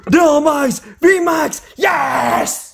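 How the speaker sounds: exclamations aiguës et criées; cris de joie. Real, not acted. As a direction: crie ta victoire. Trouve une carte rare. (cris de joie en découvrant la carte)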